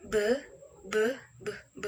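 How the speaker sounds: b as a short voiced "beh"; isolated "b" sounds, not the letter name; consonant sound demo. Shown as a demonstration. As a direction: sound out b repeatedly, spaced. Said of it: "cara bacanya adalah".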